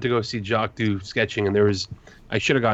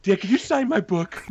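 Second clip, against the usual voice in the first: higher, eager plea in a put-on voice; pleading call; 11 semitones higher than usual. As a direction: beg him to sign, pitched way up. Legends begging for signature.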